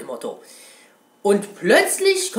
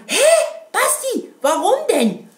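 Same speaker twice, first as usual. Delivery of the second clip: high, squeaky mock-confused viewer; puzzled viewer; 13 semitones higher than usual. Imagined viewer asking the streamer why, high and puzzled. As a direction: play a baffled fan asking why. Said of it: "Und jetzt werdet ihr sagen".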